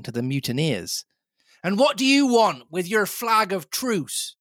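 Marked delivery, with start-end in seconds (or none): 1.6-4.3 high, carrying demand; cried challenge. A narrator's reading. Captain crying out to the mutineers, challenging. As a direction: call out sternly to the enemy. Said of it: "he cried".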